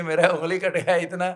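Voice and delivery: acted-out royal annoyance; angry king. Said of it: "राजा को बड़ा गुस्सा चला".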